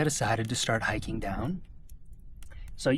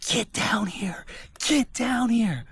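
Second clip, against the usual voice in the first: retold yelling; 11 semitones higher, slower than usual. Hiker imitating the person yelling at him, high strained yell. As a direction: imitate an angry order yelled up a mountain. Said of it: "They're yelling at me".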